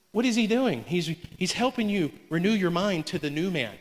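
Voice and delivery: normal talking voice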